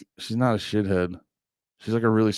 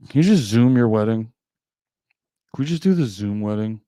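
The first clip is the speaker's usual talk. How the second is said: usual speaking voice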